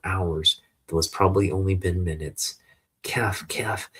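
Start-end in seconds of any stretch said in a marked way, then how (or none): 3.0-3.9 spoken-out smoky cough, unvoiced; acted cough. Boy choking on smoke, acted cough. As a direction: fake a weak cough in words.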